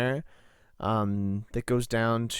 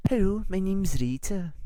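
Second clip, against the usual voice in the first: higher, bright fiery Scottish redhead; Scottish accent; 7 semitones higher than usual. Acted. Rita greets the buyers in her chosen Scottish accent. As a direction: put on a lively Scottish lilt. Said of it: "fiery Scottish redhead".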